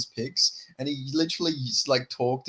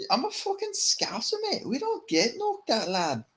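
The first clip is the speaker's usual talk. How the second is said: high, soft mock Scouse; botched Liverpool accent drifting Irish; badly faked high-pitched Scouse; Scouse slipping into Irish; 12 semitones higher, 6 dB quieter than usual. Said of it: "that went very Irish as well".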